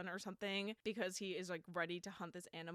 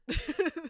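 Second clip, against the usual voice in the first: loud laugh; 8 semitones higher, 9 dB louder than usual. Real, not acted. Laughing at own point.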